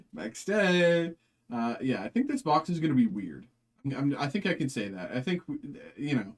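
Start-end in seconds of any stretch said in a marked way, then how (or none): 0.1-1.1 laughing exclamation. Real, not acted. Reacting to a bad pull.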